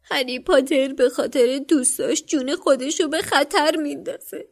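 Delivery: the elf moans in admiration then begs; moaning elf. A narrator's reading. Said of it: "با ناله گفت".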